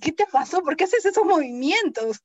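high, loud alarmed asking; scared friends. Retold or imitated. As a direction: voice the friends' panicked questions. Friends voiced, scared by seizure.

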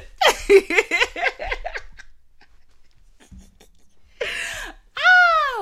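loud high cackle rolling on; sustained real laughter; long high laughing fit. Real, not acted.

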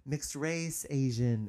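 high, slow dawning realization; realizing surprise. Retold or imitated. Recalling her own realization that she's mixed, surprised and slow. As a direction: gasp out the discovery slowly and high. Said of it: "I thought".

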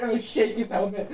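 usual speaking voice

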